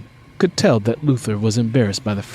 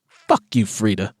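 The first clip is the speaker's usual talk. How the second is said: snapped; 2 semitones lower than usual. Acted. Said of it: "Carmen snapped at her".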